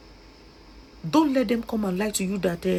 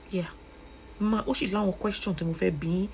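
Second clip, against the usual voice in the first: ordinary talk, nothing marked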